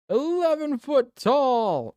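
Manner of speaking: high, loud and slow; amazed exclaim. Real, not acted. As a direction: stretch it out in disbelief.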